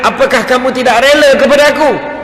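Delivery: high, ringing divine question; Allah. Retold or imitated. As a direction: raise the voice and ask it grandly. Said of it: "Allah Ta'ala tanya".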